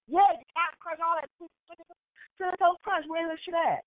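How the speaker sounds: high-pitched impatient rattling off; exasperated confirmation. Retold or imitated. Customer finally understood by the clerk, exasperated. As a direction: pitch it right up, fed up.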